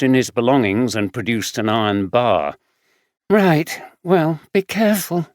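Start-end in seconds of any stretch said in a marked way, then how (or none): 3.3-5.3 a hint of a higher young woman's voice; caring friend, a bit weary; young homeless woman. Samantha voiced.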